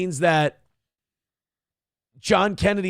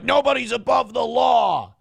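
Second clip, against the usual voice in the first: mock righteous shout; 8 semitones higher than usual. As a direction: shout it like an indignant protester. Mimics chanting political accusers of Trump.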